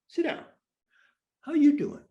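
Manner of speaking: high, loud bedside-manner voice, teasing; mock doctor. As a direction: ham up the doctor's own question. Mock-turning the doctor's question.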